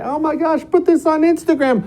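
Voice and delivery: excited onlooker. Retold or imitated. A bystander spotting the balloon overhead, high excited gush. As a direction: squeal it like someone reaching for a phone.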